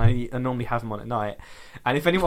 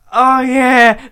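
high, loud hammed-up moaning oh yeah; mock porn moan; 12 semitones higher, 14 dB louder than usual. Joking to Jess what ASMR videos don't do, mock moaning. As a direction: ham up a breathy porn moan. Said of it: "They don't sit there and be like".

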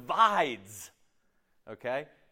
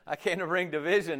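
usual speaking voice

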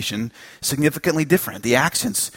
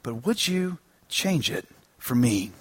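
gentle Holy Spirit; 4 semitones lower, 8 dB quieter than usual. Retold or imitated. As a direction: ask it quietly, as a loving invitation. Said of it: "The Holy Spirit said".

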